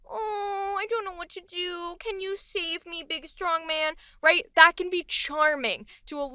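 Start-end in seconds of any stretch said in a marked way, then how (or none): 0.1-3.9 soft, high damsel pout; pouty helplessness. Acted. Woman playfully charming a big strong man, pouty and helpless. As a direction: plead sweetly through a pout, playing helpless. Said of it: "She's got the pout on".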